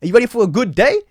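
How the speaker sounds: exaggerated bright good morning; cheery greeting. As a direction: chirp it like a sunny morning. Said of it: "I said".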